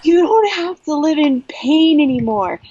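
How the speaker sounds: high, emotional retold yell; excited yelling. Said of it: "I was yelling at him".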